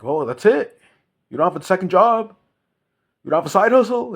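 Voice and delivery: high, loud mock disbelief; high mock shock; mocking incredulous voice. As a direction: scoff like a judging busybody. Mocking what people say.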